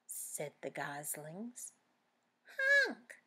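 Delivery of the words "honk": high, loud little honk; Pip's squeaky honk. Acted. Little Pip copying the goslings, high and loud. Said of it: "said Pip".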